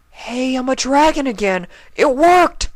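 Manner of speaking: put-on very high, loud delighted kid voice; excited Spike. Spike discovering he's a dragon again, high and loud. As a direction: shout with joy at being back.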